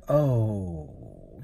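low, drawn-out sympathetic "oh"; heart sinking. Retold or imitated. Driver hears the passenger's dad died, heart sinking. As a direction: let it sag as the news lands. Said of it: "my heart sinks a little bit".

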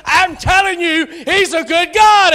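full-force preaching peak; preaching shout. Real, not acted. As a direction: cry it out to the congregation. Preacher building to climax.

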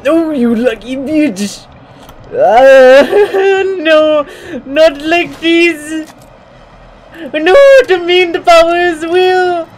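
real panic with a laugh; panicked yelling. Real, not acted. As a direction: scream as the game turns on you. Panicked yelling as power fails.

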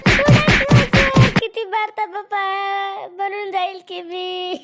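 loud, slow wail; wailing plea. Acted. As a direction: howl in pain begging him to stop. Boy wailing under beating.

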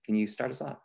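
ordinary talk

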